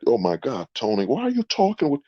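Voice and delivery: mocking shocked bar patron. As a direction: squeal it like a scandalized bargoer. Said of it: "you'll see that".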